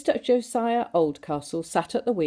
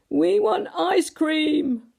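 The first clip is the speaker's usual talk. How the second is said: put-on much higher, very slow whining children; children wailing; 10 semitones higher, much slower than usual. A narrator's reading. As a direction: drag it out like sulky kids. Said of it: "wailed the children".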